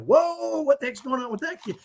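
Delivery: gawk aloud like a shocked bystander; acted-out alarm, pitched well up; startled onlookers. Voicing startled onlookers at a meltdown.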